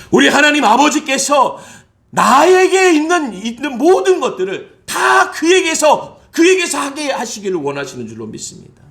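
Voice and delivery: loud, high, slow proclamation; fervent preaching. Acted. Pastor proclaiming God's will, fervent preaching. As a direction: draw out every word.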